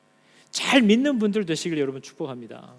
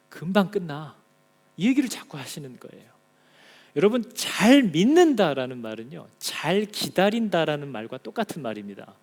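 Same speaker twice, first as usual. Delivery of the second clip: normal talking voice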